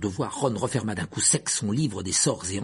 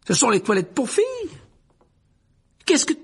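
loud, high, scandalized cry; outraged shout; 4 dB louder than usual. A narrator's reading. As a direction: shout at them in shock. Percy scandalized in girls' toilets. (cri indigné et aigu)

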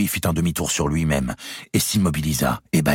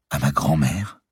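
plain reading voice

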